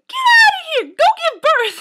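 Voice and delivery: very high, very loud shrieking lady; patient screaming at the overdue nurse to go give birth; mock screaming. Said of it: "this lady started screaming".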